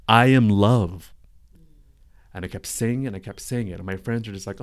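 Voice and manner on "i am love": louder, slow declaration of I am love; loud proclamation. Retold or imitated. Coming out of the trance, declaring it loudly to friends. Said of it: "Listen to how Maddie is saying".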